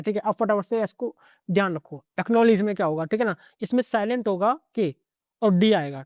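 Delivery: ordinary talk, nothing marked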